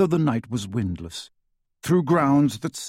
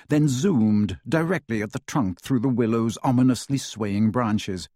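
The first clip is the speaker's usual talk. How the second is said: ordinary delivery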